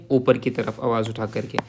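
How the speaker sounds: ordinary delivery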